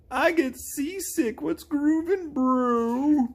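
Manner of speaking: very high, slow cartoon voice; Goro. Game character greeting a bro, very high drawn-out voice. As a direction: squeal it out, stretching every word.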